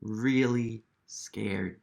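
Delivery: slow, drawn-out really scared; slow explanation. Shown as a demonstration. Reader explaining a word to children, slow and drawn out. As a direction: stretch it out slowly for kids. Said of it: "What does terrified mean?".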